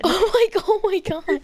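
high, cracked-up exclamation; laughing. Real, not acted. Reacting to creepy carol lyrics, laughing high. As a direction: say it through helpless laughter.

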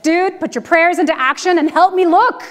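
mother losing patience with a useless neighbour, loud; exasperated retort. Retold or imitated. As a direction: tell him off, sharp and impatient. Snaps at praying stranger.

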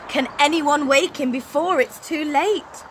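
ordinary delivery, nothing marked

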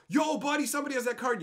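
high, loud retold holler; calling out. Onlookers alerting their buddy about a wanted card, calling out. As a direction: imitate hailing a pal across the room.

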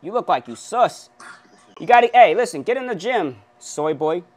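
ordinary talk

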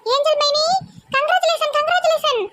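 put-on high, squeaky girlish voice, a light touch; bubbly young girl squealing congratulations; excited sister-in-law.

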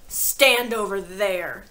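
loud, slow command; stern order. A narrator's reading. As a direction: order him aside. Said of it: "the Trunchbull ordered".

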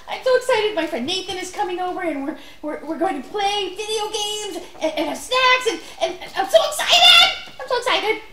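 high, loud, gushing and fast, laughing; bouncing off the walls with glee; bursting with excitement. Acted. Child to puppet friend, overexcited and shouting happily.

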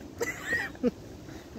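high squealing laugh. Real, not acted. Laughing at the Ford joke.

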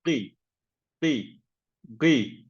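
"Gıy" with a heavy, thick ı vowel; exaggerated thick "gıy" as a warning; overly thick gıy. Shown as a demonstration. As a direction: say "gıy" too thick, the wrong way. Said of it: "asla ı sesiyle böyle aşırı kalın bir şekilde okunmayacağız".

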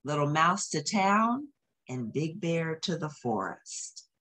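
ordinary delivery, nothing marked

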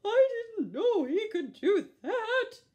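high, loud astonished voice; amazed kitten. A narrator's reading. Kitten stunned by the pig, high amazed squeak. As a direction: squeak it out in surprise.

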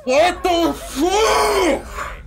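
slow, high, crying shout; a real anguished wail. Real, not acted. Gamer dying again, crying out in despair.

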